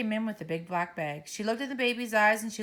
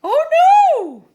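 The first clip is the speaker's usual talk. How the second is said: yelled; 18 dB louder than usual. Acted. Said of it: "yelled the mother".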